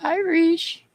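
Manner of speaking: high singsong call into the room. Real, not acted. Calling out for absent viewer.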